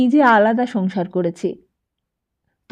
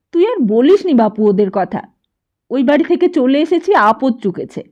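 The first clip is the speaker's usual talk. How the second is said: much louder, angry mother; angrier mother; 4 semitones higher, 9 dB louder than usual. A narrator's reading. Mother cutting off her son's talk, angry and loud. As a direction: shut the topic down in anger. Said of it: "মা আরও রেগে যেত".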